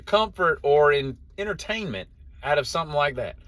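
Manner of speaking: can't believe anyone enjoys this; baffled disbelief. Real, not acted. Vlogger baffled by D and D, incredulous high voice.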